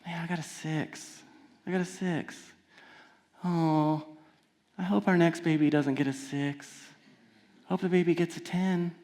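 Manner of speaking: mild mock sulk; sulking kid. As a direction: mope quietly over the score. Child still sulking.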